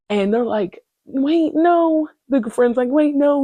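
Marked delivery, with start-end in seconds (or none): 1.1-2.1 pleading call. Retold or imitated. Friends calling after her. 2.9-3.4 pleading call. Retold or imitated. Friend pleading as she leaves.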